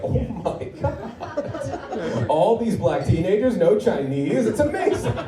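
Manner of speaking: high, slow gushing wonder; amazed customer. Retold or imitated. Clueless diner marvelling at the staff, mock amazed. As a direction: gush like a clueless tourist. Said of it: "just going to Panda Express, like".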